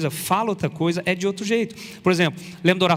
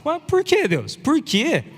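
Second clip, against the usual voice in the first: much higher, squeaky pleading cry of why; pleading protest; 10 semitones higher, slower than usual. Acted. A believer complains to God, high pleading protest.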